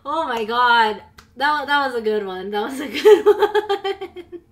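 loud breathless laughter; can barely get words out; laughing hard. Real, not acted. Laughing at alert.

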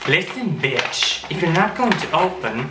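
slow, mocking put-on sass; sassy diva voice. Voicing a bossy letter at herself in mock sass. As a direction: drawl it out like a diva.

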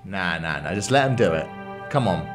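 ordinary talk, nothing marked

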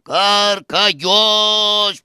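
name stretched into a very slow wail; long hammed-up grieving call; mourning cry. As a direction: call out to the dead, dragging out the name. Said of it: "Τι φωνάζεις, με ξεκούφανες".

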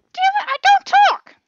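very high, loud squawked dog voice; shrieky high put-on pet voice; exasperated talking dog. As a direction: shout it as an indignant cartoon dog. Voices the dog answering back.

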